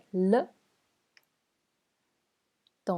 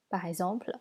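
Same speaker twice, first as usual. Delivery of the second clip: ordinary talk, nothing marked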